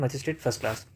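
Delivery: usual speaking voice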